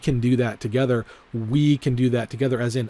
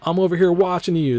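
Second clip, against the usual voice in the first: Joe's voice; 6 semitones higher, 4 dB louder than usual. Retold or imitated. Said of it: "And Joe's like".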